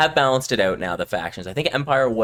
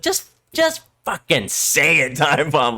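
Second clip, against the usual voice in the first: higher, drawn-out exasperated urging; Gonk; 4 semitones higher, slower than usual. Retold or imitated. Friend calling out a too-polite Canadian, blunt exasperation. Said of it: "Gonk calls me out on it".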